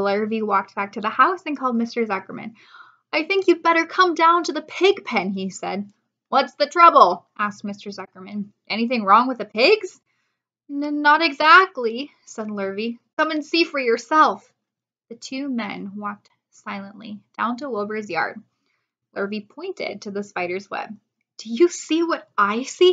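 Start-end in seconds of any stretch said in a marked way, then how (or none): 3.1-5.3 higher, louder farmhand voice; urgent hired man; Lurvy voice. Acted. 6.3-7.3 farmer voice. Acted. Zuckerman voiced. 8.7-10.0 farmer voice. Acted. Zuckerman voiced. 10.7-12.1 nervous stammer. Acted. 13.2-14.5 farmhand voice. Acted. Lurvy voiced. 21.4-22.9 higher, louder awed question; awed farmhand. Acted. Lurvy awed.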